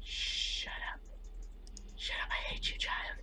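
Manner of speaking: hushed whisper at the screen. Real, not acted. Player hissing at a horror game, whispered. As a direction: whisper it so the monster won't hear.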